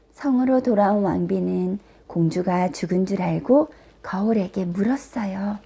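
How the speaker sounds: usual speaking voice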